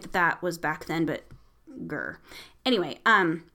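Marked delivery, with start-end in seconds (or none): 1.9-2.2 deep annoyed grr; low frustrated growl. Real, not acted. Annoyed aside about the slaves.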